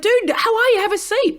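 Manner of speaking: welcoming a liked friend to her table, high and cheery; friendly greeting. Shown as a demonstration. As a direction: greet a pal warmly.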